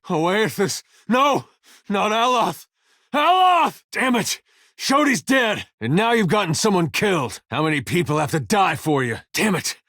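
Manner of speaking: high, desperate exclamations; anguished outcry. Acted. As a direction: cry out in horror, turning to blame. Grief and blame over fallen companions.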